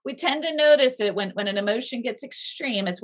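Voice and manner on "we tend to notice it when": usual speaking voice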